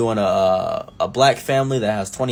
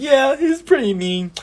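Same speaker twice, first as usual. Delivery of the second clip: much higher, slow innocent voice; fake victim; 14 semitones higher, slower than usual. Telling the party guests his boss is mean, fake innocent voice. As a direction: play the poor abused worker.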